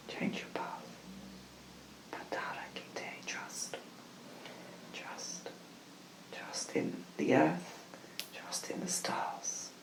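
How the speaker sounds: very soft, slow ritual whisper; hushed channelled message; whispered invocation. Acted. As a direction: whisper it like a blessing, with long pauses.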